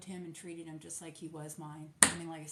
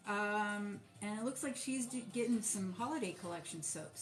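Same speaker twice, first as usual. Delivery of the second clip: ordinary delivery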